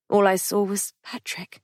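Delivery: usual speaking voice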